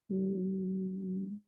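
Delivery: long, very soft closed-lip hum. Shown as a demonstration. Teacher humming despite onlookers nearby, long soft hum. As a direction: let a gentle hum ring on. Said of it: "like this with hum".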